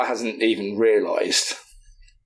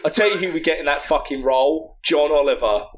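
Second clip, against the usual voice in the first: usual speaking voice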